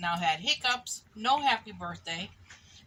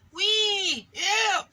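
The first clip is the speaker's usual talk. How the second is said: whee then yuck, loud and high; high loud squeal; 11 semitones higher, 9 dB louder than usual. Acted. Piglet flung from the horse's back, squealing. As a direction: shriek flying through the air. Piglet catapulted through air.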